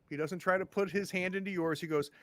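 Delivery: ordinary delivery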